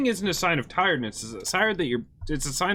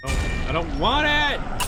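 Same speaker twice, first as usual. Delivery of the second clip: slow frightened cry, louder and higher; scared; 6 semitones higher, 5 dB louder, slower than usual. Real, not acted. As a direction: recoil in fright, dragging the words. Scared reaction to game.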